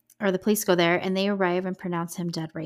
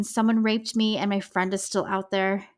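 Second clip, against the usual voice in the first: ordinary talk, nothing marked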